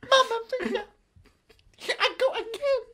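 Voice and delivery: squeaky, very high take on song lyrics; halting Icelandic singer caricature. Acted. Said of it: "Very close to [name]".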